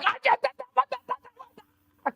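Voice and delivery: very high, nonsense jabber; mock chatter. Comedian imitating the salon workers' talk, high jabbering. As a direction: babble in a high put-on voice. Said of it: "samurai chatter".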